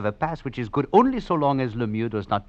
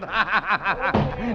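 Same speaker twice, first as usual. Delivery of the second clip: high, cruel laugh; 10 semitones higher than usual. Acted. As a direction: laugh at him with scorn. Killer laughs at victim.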